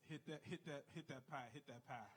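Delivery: mock sultry murmur. Mock bedroom talk, fading.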